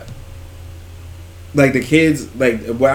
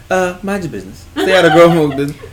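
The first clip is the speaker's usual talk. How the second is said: laughing; 6 semitones higher, 6 dB louder than usual. Real, not acted. Laughing scolding tease.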